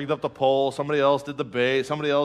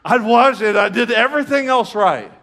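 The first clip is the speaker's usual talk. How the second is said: high, loud comic protest; exasperated outcry; 8 semitones higher, 9 dB louder than usual. Retold or imitated. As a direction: protest loudly at your own blunder. Comic frustration at his own mistake.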